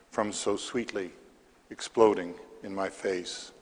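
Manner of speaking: measured verse with pauses between lines; poetry reading. Acted. Poet reads to a library audience, measured poetry reading. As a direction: read the poem aloud, each phrase set apart. Reads own poem aloud.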